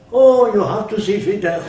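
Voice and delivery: hammed-up eager urging, imitating the technicians; excited insistence.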